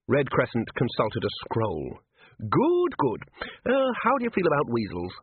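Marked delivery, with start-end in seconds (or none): 2.4-5.1 put-on very high, aghast little old man; scandalized at the very idea; high fluttery herald voice; fussy old herald.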